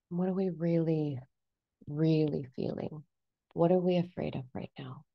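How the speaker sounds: soft, unhurried reassurance with long pauses; gentle coaxing. Acted. Coach to own fearful ego as baby bunny, softly soothing. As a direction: coax softly, as to something frightened.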